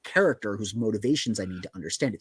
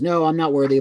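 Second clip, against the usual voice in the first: pleading cry; 5 semitones higher, 6 dB louder than usual. Retold or imitated. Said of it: "the guy goes".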